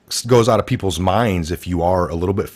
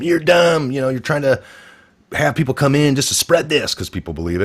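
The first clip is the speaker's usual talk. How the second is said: mock accusers; 7 semitones higher than usual. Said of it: "people arguing with him".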